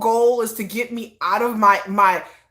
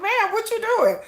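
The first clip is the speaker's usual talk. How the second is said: mock high voice; 9 semitones higher than usual. Imitating people at idol parade.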